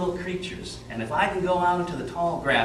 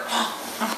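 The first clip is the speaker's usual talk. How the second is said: mock gasp; 4 dB quieter than usual. Storyteller hears inchworm burp.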